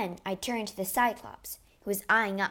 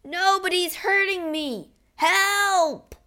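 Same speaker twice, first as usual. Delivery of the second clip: high, loud pained scream; blinded giant screaming for help; 10 semitones higher, 6 dB louder, slower than usual. Acted. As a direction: shriek for help in agony. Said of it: "screamed out".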